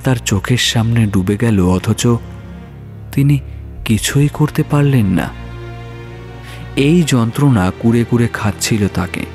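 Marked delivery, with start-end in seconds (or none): none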